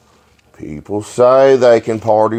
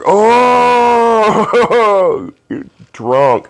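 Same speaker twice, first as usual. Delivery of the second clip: mock drunk cry; 12 semitones higher, much slower than usual. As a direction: bellow it like a hammered guy. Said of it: "I was like".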